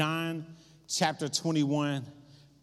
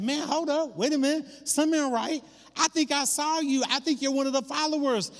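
high-pitched retold accusation; suspicious accuser; 9 semitones higher, faster than usual. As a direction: call him out, voice pitched well up. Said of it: "Somebody else said".

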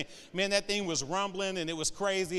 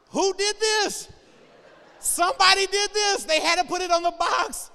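incredulous outcry; 12 semitones higher, 8 dB louder than usual. Real, not acted. Outraged at the stroller label.

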